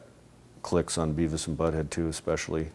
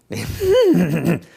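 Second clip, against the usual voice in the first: high, loud stuttering cartoon cackle; higher-register laugh; 11 semitones higher, 8 dB louder than usual. Shown as a demonstration. Demonstrating the character's later laugh, high spastic cackle. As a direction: push a spastic snicker way up high. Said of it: "kinda higher register of, like, where he's, like, kinda going".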